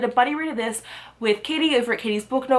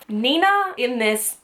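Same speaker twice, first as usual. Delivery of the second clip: ordinary delivery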